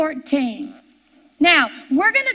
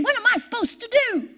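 mock helpless Moses; 8 semitones higher, much faster than usual. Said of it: "Moses is looking to God saying".

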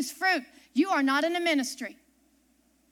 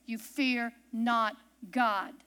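slow emphatic scripture; 5 semitones lower, much slower than usual. Shown as a demonstration. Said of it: "Do you hear that".

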